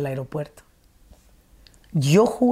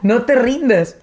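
higher, louder cheery dad pep talk; host voicing the supportive dad she expected, higher and louder; mock encouraging dad; 5 semitones higher, 4 dB louder, slower than usual. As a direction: play the cheering father, hammed up.

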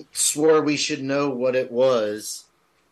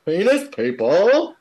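Australian impression; 8 semitones higher, 6 dB louder than usual. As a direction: ham up the Aussie bloke. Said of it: "this is the Australian guy".

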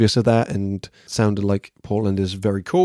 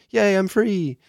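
high, bright "Yay, I'm free"; cheerful exclaim; 10 semitones higher, slower than usual. Shown as a demonstration. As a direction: sing out the joy. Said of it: "as in".

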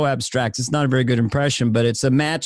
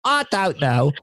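higher, exclaiming Cockney impression; Michael Caine; 4 semitones higher than usual. Shown as a demonstration. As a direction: let the Cockney voice climb. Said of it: "You go higher".

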